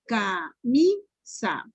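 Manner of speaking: "Camisa" drawn out syllable by syllable, MI stressed; slow syllable-by-syllable. Shown as a demonstration. Teacher demonstrating the tonic syllable, "Camisa" slowly split with MI stressed. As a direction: break "Camisa" slowly into ca, mi, SA, leaning on MI. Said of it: "la pronuncien por sílabas despacito".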